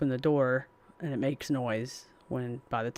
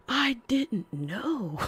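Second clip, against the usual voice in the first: mock innocent; 10 semitones higher, much slower than usual. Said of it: "And I'm like".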